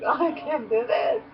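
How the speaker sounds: high, crying whine; hammed-up high sob; mock whine. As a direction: wail it like a whiny kid. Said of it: "and I'm like".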